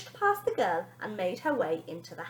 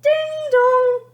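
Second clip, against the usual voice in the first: high sung doorbell chime, louder; doorbell ding dong; 14 dB louder than usual. Acted. Narrator imitating the doorbell, high and loud. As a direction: sing it like a doorbell. Doorbell rings third time.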